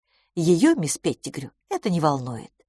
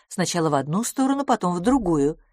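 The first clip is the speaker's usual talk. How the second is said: ordinary delivery, nothing marked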